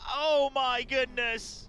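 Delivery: loud, slow, high gasp of disbelief; shocked exclamation. Real, not acted. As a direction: stretch out the oh in amazement. Caster reacts to big play.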